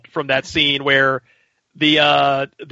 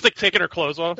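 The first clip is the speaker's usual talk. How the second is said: ordinary talk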